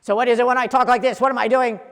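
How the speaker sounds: loud, brisk, forceful delivery; loud demo. Shown as a demonstration. Coach demonstrating tone to a class, loud and brisk. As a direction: push it out loud and fast. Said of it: "What's the tone difference?".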